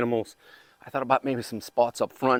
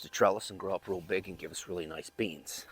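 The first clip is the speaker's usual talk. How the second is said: whispered aside, voice dropped to breath; 8 semitones lower, 10 dB quieter than usual. Real, not acted. Gardener whispering his bean plans to the camera. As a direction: lean in and whisper it to the viewers.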